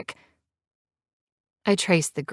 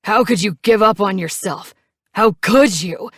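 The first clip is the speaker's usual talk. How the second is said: louder, higher furious accusation; angry yell; 4 semitones higher, 8 dB louder than usual. A narrator's reading. Knives yelling at Mary for giving up, loud. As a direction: throw the question at her. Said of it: "he yells at me".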